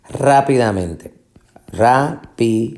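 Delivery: rápidamente with RÁ stressed, falling after; stress on the first syllable. Shown as a demonstration. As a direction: say rápidamente slowly and clearly, leaning on RÁ. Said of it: "la fuerza de pronunciación". (rápidamente con acento en RÁ, bien marcado)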